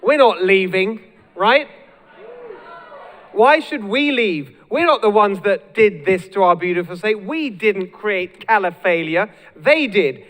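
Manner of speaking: loud, high-pitched stump speech; impassioned protest thrown out to a hall; defiant rallying. Real, not acted. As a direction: fire up the crowd in defiance.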